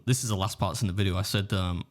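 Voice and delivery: ordinary talk, nothing marked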